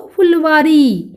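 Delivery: फुलवारी slow, with long final ई; pronunciation demo. Shown as a demonstration. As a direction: model फुलवारी slowly for pupils. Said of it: "कैसे उच्चारण होगा?".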